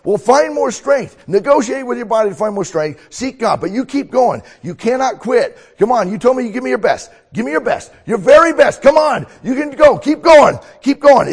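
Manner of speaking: very high, loud coach yelling; hoarse marathon cheering. Acted. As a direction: scream encouragement from the sideline. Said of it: "I'm gonna be a coach".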